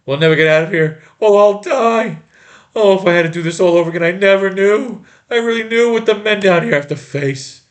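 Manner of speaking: loud, high, anguished lament; despairing wail. Acted. Despair while trapped.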